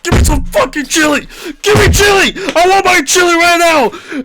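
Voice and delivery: hammed-up furious customer shouting; angry yelling. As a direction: scream for chili like a furious customer. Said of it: "He was getting pissed".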